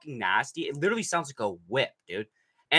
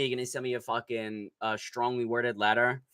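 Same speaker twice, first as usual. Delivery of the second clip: ordinary talk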